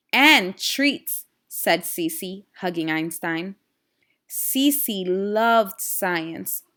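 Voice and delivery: lively storybook reading with voiced characters; reading to children. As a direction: read the story aloud to a class of kids, animated. Teacher reads storybook to class.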